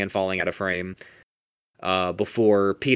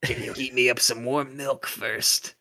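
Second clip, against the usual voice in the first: feeble invalid voice; 5 semitones higher than usual. Retold or imitated. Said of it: "weak little rested voice".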